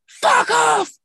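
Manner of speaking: high, loud mock shout; mock snarl. Kate snapping at her sister in the morning, loud mock shout. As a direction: bark it back like a grumpy flatmate. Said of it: "how bad Kate's, um, mood swings can be".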